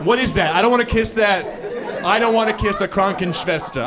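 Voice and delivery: high, loud, panicky refusal; mock scared. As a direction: protest in comic alarm. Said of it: "I'm scared".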